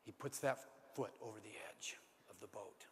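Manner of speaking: whispered, hushed suspense; whispered storytelling at the tense moment. A narrator's reading. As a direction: breathe it as the moment hangs.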